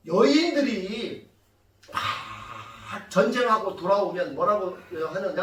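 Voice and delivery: ordinary talk, nothing marked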